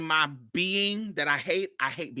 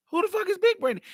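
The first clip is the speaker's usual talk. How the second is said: much higher, incredulous question; stunned disbelief; faster than usual. Retold or imitated. Retelling his shock to Mario: a high, incredulous question. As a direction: ask it like you can't believe the name.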